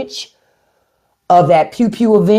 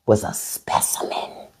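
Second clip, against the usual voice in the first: hushed, slow admiring whisper; 8 semitones lower, 12 dB quieter, slower than usual. Real, not acted. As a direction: whisper it like a secret. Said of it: "whispered admiration".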